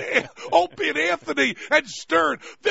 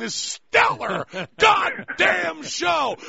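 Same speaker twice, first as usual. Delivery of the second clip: mock-outraged hype; 7 semitones higher, much slower than usual.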